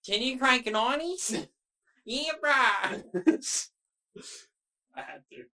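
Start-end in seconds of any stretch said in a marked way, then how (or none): 0.1-1.4 put-on high, loud, drawn-out kid impersonation; mock Fortnite kid. Mock interview, imitating a Fortnite kid. 2.0-3.7 put-on high, loud, drawn-out kid impersonation; mock Fortnite kid.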